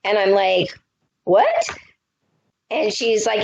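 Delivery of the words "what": high squeaky disbelief; shocked yelp of disbelief; shocked what. Retold or imitated. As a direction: leap up in pitch, stunned. Shocked at news of husband.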